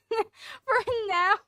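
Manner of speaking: high laughing. Real, not acted. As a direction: laugh through it. Laughing shriek.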